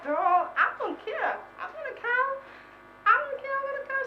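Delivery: high, sassy "I don't care"; defiant retort. Retold or imitated.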